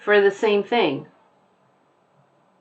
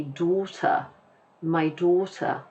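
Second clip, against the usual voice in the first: softer British daughter, final r dropped; British accent; 6 dB quieter, slower than usual. Shown as a demonstration. Teacher imitates a British speaker for learners, British accent. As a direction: put on a quiet British "daughter". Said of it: "British to say the word daughter".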